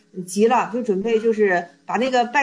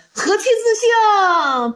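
high, loud, very slow proclamation; drawn-out exclamation; 9 semitones higher, 6 dB louder, much slower than usual. Acted. Teacher voices the Sixth Patriarch's awakening, exclaiming grandly. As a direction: cry it out and stretch the last word.

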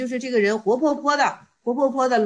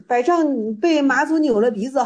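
normal talking voice

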